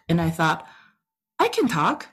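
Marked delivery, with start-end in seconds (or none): 1.4-2.1 high-pitched retold protest; mock indignant, rising higher at the end. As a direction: protest your own ability, pitched up and quick. Retold protest to her son.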